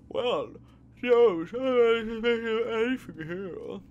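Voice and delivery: very high, loud and dragged-out dodge; evasive suspect. Acted. Suspect squirming out of the question, high and drawn-out. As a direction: stretch each word out, cagey and shrill.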